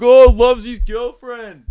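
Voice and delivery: high, loud, slow singsong taunt; loud jeering mockery. Mate making fun of a guy on the phone, loud jeer. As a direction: jeer it out, stretching the words.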